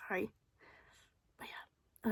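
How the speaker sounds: ordinary talk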